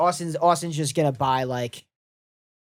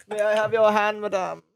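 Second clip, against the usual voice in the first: court her like a rich snob; rich dad voice; 3 semitones higher than usual. Pretend. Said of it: "do the caviar guy".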